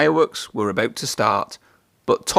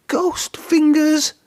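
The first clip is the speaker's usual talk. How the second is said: high, slow, frightened cry; spooked engine shrieking; terrified cry; much slower than usual. A narrator's reading. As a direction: gasp it out in horror. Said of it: "cried Thomas".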